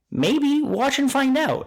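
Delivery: high, playful coy tease; teasing reply. Retold or imitated. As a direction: tease her, keep the secret. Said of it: "she would go".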